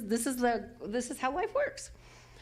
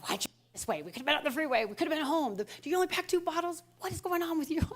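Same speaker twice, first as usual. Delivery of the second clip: higher, faster mock complaint at the husband; nagging inner voice; 5 semitones higher, faster than usual. Inner critic scolding her husband in traffic, mock exasperated. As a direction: play the annoyed passenger in you. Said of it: "[name] aside is like".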